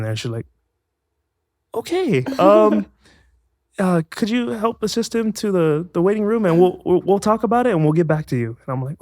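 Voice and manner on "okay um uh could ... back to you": high-pitched mimicked lady, stretched hesitant opening; awkward polite dismissal. Agency lady sending a failed actor out, high awkward politeness.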